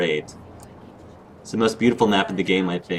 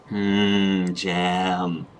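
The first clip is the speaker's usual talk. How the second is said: savouring; 2 semitones lower than usual. Real, not acted. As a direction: linger on it like something tasty. Savours mention of jam.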